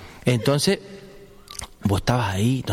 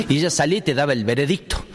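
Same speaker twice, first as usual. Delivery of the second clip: ordinary talk, nothing marked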